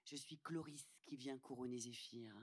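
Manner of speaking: ordinary talk, nothing marked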